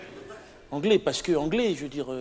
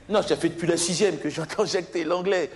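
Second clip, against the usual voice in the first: ordinary talk, nothing marked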